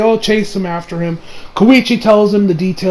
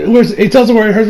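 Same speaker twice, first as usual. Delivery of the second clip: usual speaking voice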